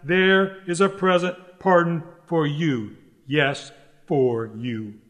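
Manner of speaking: slow, deliberate stress on "present pardon for you"; emphatic. Real, not acted. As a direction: spell out "for you" word by word, dropping low. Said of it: "what I want to say most emphatically".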